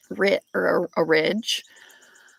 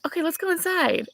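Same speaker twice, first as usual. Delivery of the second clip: bright, pitched well up, hiding the fear; forced calm to child; 12 semitones higher than usual. Retold or imitated. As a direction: keep it light so the boy won't worry. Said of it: "and I was like".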